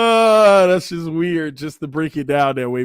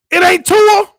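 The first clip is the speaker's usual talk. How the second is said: stunned disbelief; 14 semitones higher, 7 dB louder than usual. Real, not acted. Shocked by the real name.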